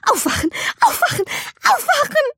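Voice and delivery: rising high, desperate repeats; frantic. A narrator's reading. Girl tries to wake from a nightmare, frantic high cries. Slapping her face to wake.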